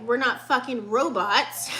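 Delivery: usual speaking voice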